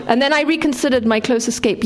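plain reading voice

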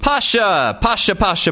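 high urgent shout of "Pasha". A narrator's reading. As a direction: call out "Pasha" over and over. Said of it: "Anton shouted".

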